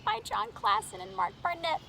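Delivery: high, announcer-style title read; title announcement. Acted. Reader to kids, announcing the title grandly.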